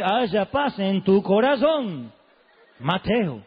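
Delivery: slow, drawn-out soothing voice; forced calm. Retold or imitated. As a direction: force yourself to sound peaceful. Padre se calma a la fuerza. (el padre conteniéndose, lento y forzadamente sereno)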